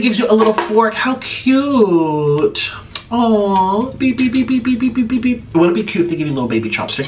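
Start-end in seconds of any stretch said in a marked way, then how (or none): 3.9-5.5 high cutesy beep beep beep; playful beeping. Pretend. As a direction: chirp the beeps like a toy. Playing with tiny fork.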